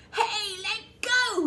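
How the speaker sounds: loud, high, slow protest; yelled. A narrator's reading. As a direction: yell while dangling upside down. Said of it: "yelled the enormous crocodile".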